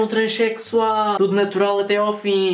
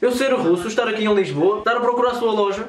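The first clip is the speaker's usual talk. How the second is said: put-on broken-Portuguese Russian accent; 2 semitones higher than usual. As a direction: play a lost Russian, heavy accent. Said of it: "com a famosa voz de russo".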